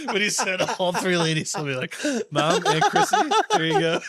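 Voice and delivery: words bubbling with laughter; laughing through words. Real, not acted. Telling his cohost what he almost said on air, laughing through it. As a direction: keep the laugh running under the lines.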